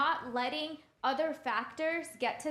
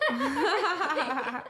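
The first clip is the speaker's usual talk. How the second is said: laughing; 4 dB louder than usual. Real, not acted. Joking that their brain fires at everything, laughing. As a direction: cut off by a laugh.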